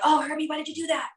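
high, quick mock telling-off; imitated scolding. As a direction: act out being mad at the puppy. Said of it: "when I'm mad at him".

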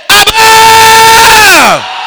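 long high held shout; shouted cry. Real, not acted. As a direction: cry out Abba with everything. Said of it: "Everybody say".

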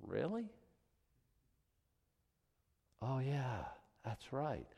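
soft, wry mock surprise; amused God. God answering the parent who loves most, soft and wry. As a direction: let the irony land quietly.

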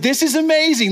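disbelieving joy walking on water; excited. Retold or imitated. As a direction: play Peter thrilled as it works. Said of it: "there had to be excitement".

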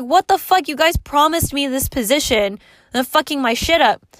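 imitating her own angry cursing; retold outburst. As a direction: blast the company for breaking its promise.

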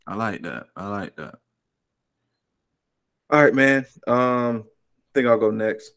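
ordinary talk

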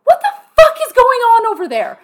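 high, loud demand down the phone; outraged phone shout; alarmed shout. Retold or imitated. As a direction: yell it in disbelief. Calls sister, alarmed.

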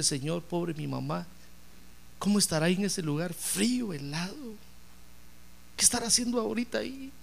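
soft, tearful prayer for her dead mother; tearful plea. Retold or imitated. Grieving daughter praying to the Lord, soft and tearful. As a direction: plead quietly, as if near tears. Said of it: "se puso a llorar y le dijo".

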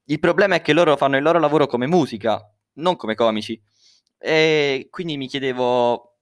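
ordinary delivery

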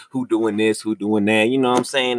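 normal talking voice

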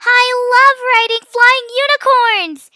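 high, loud whoop of delight; excited shout. A narrator's reading. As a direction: shout it out, thrilled by the ride. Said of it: "Mia shouted excitedly".

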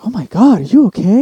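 high, loud retold alarm; concerned mom. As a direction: imitate a worried mother fussing. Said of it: "my mom was really concerned".

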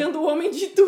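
título gritado com alegria; empolgado. Real, not acted. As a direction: anuncia o filme empolgado. Título dito com entusiasmo. (empolgado e alto)

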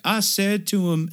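high, slow protest; brother protesting. Retold or imitated. Brother protesting again, high and slow. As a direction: whine it out high and drawn. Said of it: "he's like".

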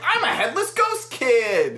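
high, slow mock kid announcement; ghost kid. Headless kid introducing himself, high ghost-kid voice.

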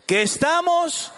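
high, chant-like call in short phrases; forceful group reading. Real, not acted. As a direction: lead the congregation, each phrase punched out. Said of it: "lo leemos con fuerza, con gana". (lectura con fuerza, para que todos repitan)

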